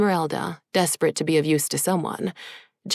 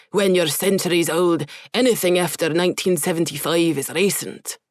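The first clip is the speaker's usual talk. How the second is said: Scottish brogue. Acted. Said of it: "his brogue returning".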